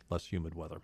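ordinary talk, nothing marked

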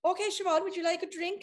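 eager host pressing a drink; Lars offering a drink. Retold or imitated. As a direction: imitate him coaxing her to drink. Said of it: "it's like".

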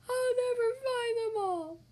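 high, slow tearful wail; crying. Acted. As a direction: cry it out, everything is lost. Squirrel's acorns scattered.

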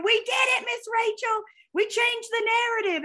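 high-pitched, excited mock scream; imitating players' screaming. Players yelling to their mentor about their win, imitated high scream. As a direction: squeal it out like players running off the field. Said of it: "they're screaming".

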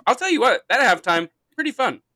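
excited. Acted. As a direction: hype it up. Hyped after halftime.

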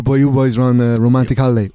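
ordinary talk, nothing marked

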